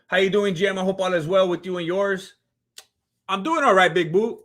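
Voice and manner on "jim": normal talking voice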